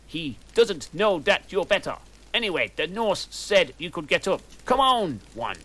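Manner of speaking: a hint of an eager teacher urging her up; professor voice. Professor telling the heroine to get up, lightly voiced. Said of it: "said Professor Trevelyan".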